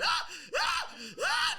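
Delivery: very high, loud repeated shrieks; short piercing yelps, one after another; freaking-out screams. Retold or imitated. Comic imitating his panicking son, high screams. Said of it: "He's freaking out, just going".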